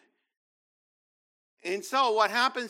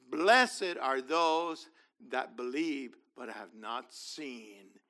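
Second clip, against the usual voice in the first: solemn slow proclamation; 4 dB louder, slower than usual. Acted. Preaching Yeshua's words slowly.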